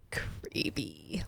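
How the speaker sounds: breathy hushed whisper after a gasp; a spooked whisper; whispered creepy. Real, not acted.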